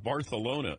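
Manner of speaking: Barcelona with a th in the middle; lisped pronunciation. Retold or imitated. World-traveler son describing his trip, Barcelona with a th. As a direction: say Barcelona as Bar-thuh-lona. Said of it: "You got a speech impediment?".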